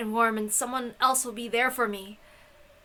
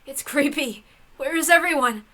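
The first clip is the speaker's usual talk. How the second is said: building from uneasy to a loud, higher panicked cry; rising panic; 3 semitones higher, 9 dB louder than usual. Acted. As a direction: fear swelling into a frantic plea to get out. Panic rising, wants to escape.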